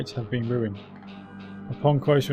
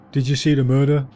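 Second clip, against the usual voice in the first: troll voice; 6 dB louder than usual. Acted. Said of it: "troll voice".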